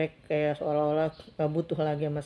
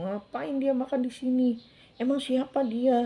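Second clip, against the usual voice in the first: put-on higher-pitched, indignant child's complaint; imitated grumbling; 7 semitones higher, 3 dB louder than usual. Second child objecting to stepfather at the meal, imitated grumbling. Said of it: "itu malah ngomel-ngomel gitu".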